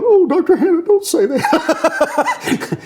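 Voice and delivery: high, slow laughing plea; a laughing, squeaky don't-say-that; laughing protest. Retold or imitated. Retells his own protest at the professor.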